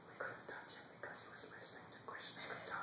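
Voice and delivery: breathy hushed whisper. Acted. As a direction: whisper it fearfully to the king. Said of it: "they came whispering".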